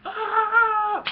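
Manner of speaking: loud, high, drawn-out "aah"; imitated crash scream. Retelling a biker about to smash into a tree, mock scream. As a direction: yell like you're about to hit a tree. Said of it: "He's like".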